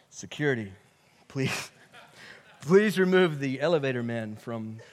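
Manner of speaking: hammed-up stern request to remove the intruder; mock Jesus voice. Voicing Jesus calling for security.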